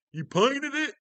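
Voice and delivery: very high, loud mock disbelief; hammed-up astonished question; amazed customer. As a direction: squeal it like a stunned customer.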